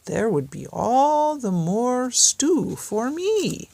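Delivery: a wolf relishing his stew plan; gloating wolf. Acted. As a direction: drag it out like a greedy wolf. Wolf's scheming thought.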